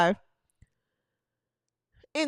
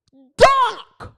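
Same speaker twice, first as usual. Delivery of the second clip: mock dunk sound; 6 semitones higher, 9 dB louder than usual. Mocking her dunking.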